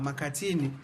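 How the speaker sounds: ordinary talk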